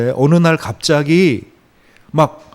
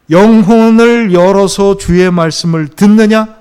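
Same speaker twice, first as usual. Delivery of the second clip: slow, much louder and higher proclamation; emphatic declaration; 6 semitones higher, 10 dB louder, slower than usual. Real, not acted. Preacher answering his own question to the church, slow loud proclamation. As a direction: proclaim it slowly with full force. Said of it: "preacher's emphatic question".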